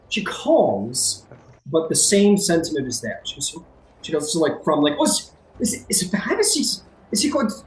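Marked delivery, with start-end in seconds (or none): 5.0-7.5 high, stammering worried mother; anxious high-pitched stammer; starting to freak out. Acted. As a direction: ask it in rising alarm, tripping over words. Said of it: "she started to freak out".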